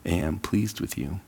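soft, slow and gentle fatherly words; a hushed father's blessing; tender blessing. Retold or imitated. Pastor voicing God the Father blessing a son, soft and slow. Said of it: "the words from God the Father".

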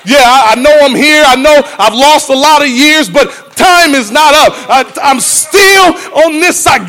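high, loud pleading to God; preacher pleads loudly for another chance; pleading preaching. Acted. Sung-style plea at full force.

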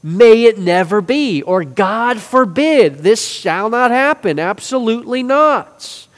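forceful alternatives, drawn out; emphatic renderings. Shown as a demonstration. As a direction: proclaim each denial slowly and firmly. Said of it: "some translations render this differently".

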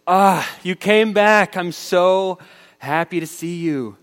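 high, loud, slow overjoyed welcome; joyful father. Acted. Father welcoming his returning son, overjoyed and loud. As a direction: welcome him home with overflowing joy.

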